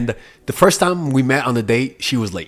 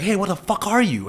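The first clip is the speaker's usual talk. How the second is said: retold scream; 7 semitones higher than usual. Russian girl snapping at him over text, mock scream. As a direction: imitate her angry demand, pitched up. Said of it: "she would text me screaming".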